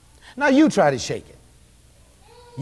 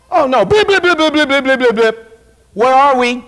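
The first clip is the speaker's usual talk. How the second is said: frantic dialing noises; 5 semitones higher, 6 dB louder than usual. Retold or imitated. Frantic phone dialing imitated.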